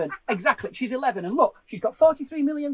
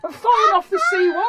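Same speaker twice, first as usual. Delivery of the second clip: loud, much higher exasperated yell; exasperated shout; 7 semitones higher, 8 dB louder than usual. Real, not acted. Husband to wife who ignores him, exasperated loud shout. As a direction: shout it to make her finally listen. Exasperated at not-listening partner.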